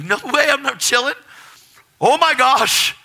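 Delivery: very high, loud outburst; freaking out. Retold or imitated. Refusing his roommate's call to chill, freaking out. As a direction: explode with uncontainable excitement. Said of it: "So I'm freaking out".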